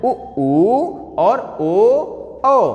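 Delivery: उ, ऊ, ओ, औ stretched very slowly, lips rounded; slow vowel demo. Shown as a demonstration. Teacher shows the rounded vowels very slowly. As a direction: sound out उ ऊ ओ औ with exaggerated rounded lips. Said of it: "कौन कौन से होते हैं?".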